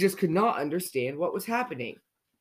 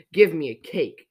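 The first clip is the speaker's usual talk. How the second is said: loud, grumpy demand; snapped; 6 dB louder than usual. A narrator's reading. Mister Grumpy orders at the bakery, loud snap. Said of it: "snapped Mister Grumpy".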